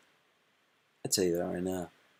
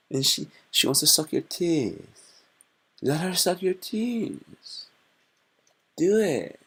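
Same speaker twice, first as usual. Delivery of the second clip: mock guru voice; 5 semitones higher than usual. Streamer advising a viewer, in a goofy high mock-earnest voice. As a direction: urge it like a goofy wise man.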